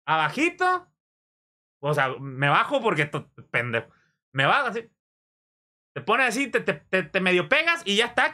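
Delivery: a streamer teaching fans photo etiquette, in a high mock-prim voice; mocking photo instructor. Shown as a demonstration. Streamer mocks fans' hugging, demonstrating in a high fussy voice. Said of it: "déjenles enseño cómo chingados lo tienen que hacer".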